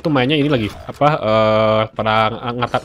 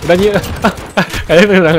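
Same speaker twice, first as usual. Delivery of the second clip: excited gamer; 8 semitones higher, 8 dB louder than usual. Real, not acted. Excited reaction in firefight.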